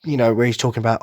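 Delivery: ordinary talk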